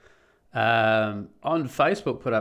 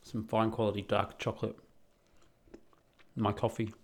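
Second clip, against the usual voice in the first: chewing while talking; 7 dB quieter than usual. Real, not acted. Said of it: "excuse the chewing".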